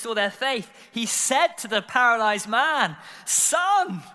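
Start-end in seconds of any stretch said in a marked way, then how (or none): 3.3-4.0 high, loud, ringing address; calling out. Acted. Jesus to the paralyzed man before the crowd, loud proclamation. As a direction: call it out as a big announcement.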